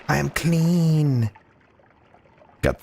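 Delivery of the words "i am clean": high, slow, drawn-out declaration; high mock proclamation. Wizard announcing to chat that he is clean in a high voice. Repeat of I'm now clean.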